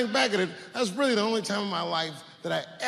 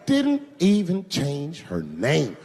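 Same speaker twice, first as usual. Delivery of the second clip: slow, word-by-word disbelief; slow emphatic repeat; much slower than usual. Acted. Comedian repeating point about Rachel, slow and deliberate. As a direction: spell it out slowly, letting each word land.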